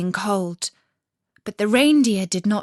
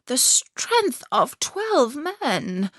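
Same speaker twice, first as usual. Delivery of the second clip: put-on slow and higher, musing old woman; old Finland woman; 5 semitones higher, much slower than usual. A narrator's reading. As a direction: draw the words out like a wise old woman. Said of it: "said the Finland woman".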